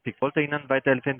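normal talking voice

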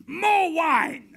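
high, loud mock-regal command; imperious shout. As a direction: bellow it like a roaring emperor. Emperor's order in retold scene.

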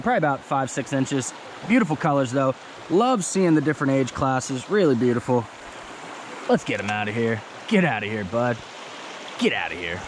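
usual speaking voice

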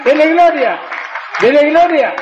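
rally the congregation to praise Jesus; preaching shout. Real, not acted.

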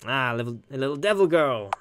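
normal talking voice

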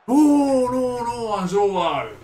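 long drawn-out high "no", falling away; anguished cry. Real, not acted. Player to striker Asdrúbal after a missed chance, anguished cry. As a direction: wail in dismay as the chance goes.

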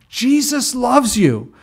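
slow, loud, high holler; acted yell. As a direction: yell each word out slowly for the whole street. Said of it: "yells at the top of his lungs".

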